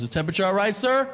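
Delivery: lightly voiced polite chauffeur, lifting high on "sir"; a touch of eager service, pitch rising to the end; professional driver. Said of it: "all of his professionalism".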